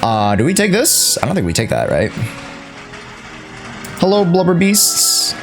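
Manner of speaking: normal talking voice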